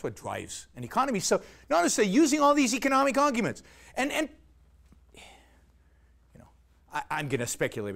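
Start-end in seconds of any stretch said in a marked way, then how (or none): 5.1-6.5 an unvoiced little aside to the audience; whispered aside. Real, not acted. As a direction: drop to a breathy whisper for just "you know". Aside mid-argument.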